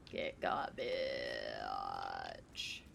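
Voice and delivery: ordinary talk, nothing marked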